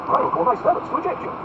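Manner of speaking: high, loud lordly warning; ringing indignation; outraged threat. Acted. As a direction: threaten him with the servants. Duke threatens to eject officer.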